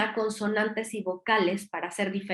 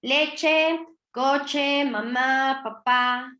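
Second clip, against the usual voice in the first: put-on toddler naming things; 4 semitones higher, 3 dB louder, much slower than usual. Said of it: "empiezan a etiquetar todo".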